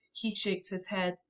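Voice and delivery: ordinary delivery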